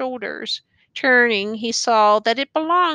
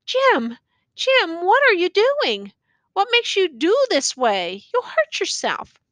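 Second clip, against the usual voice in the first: high, urgent pleading; pleading youth; 8 semitones higher than usual. A narrator's reading. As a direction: beg him to stop, pitched way up. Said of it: "began to plead".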